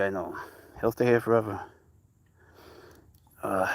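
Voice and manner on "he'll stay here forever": ordinary talk, nothing marked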